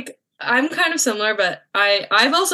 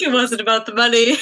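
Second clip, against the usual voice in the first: teasing imitation of a friend's tearful defence; mock teary protest. Friend teasing the teller about crying over the fine, mock tearful. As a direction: play her as wounded and weepy. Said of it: "You're like".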